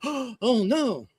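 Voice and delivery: gasp, then high-pitched dismayed cry; mock shock. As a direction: act scandalised by a fake headline. Mocks reaction to false news.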